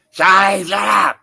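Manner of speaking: loud call across the room; playful shout at the heckler; yell. Real, not acted. Yelling at wife in background.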